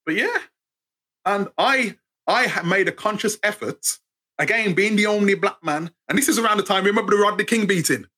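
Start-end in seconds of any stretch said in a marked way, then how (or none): none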